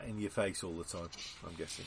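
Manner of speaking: ordinary talk, nothing marked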